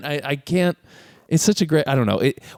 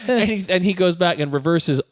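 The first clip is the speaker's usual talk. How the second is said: ordinary delivery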